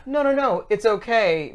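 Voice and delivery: high, gentle mock reassurance. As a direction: play the too-polite host. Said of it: "normally I'd be like".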